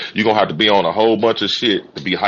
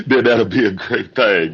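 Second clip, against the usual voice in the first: laughing; 6 semitones higher, 4 dB louder than usual. Real, not acted. Laughing at own joke.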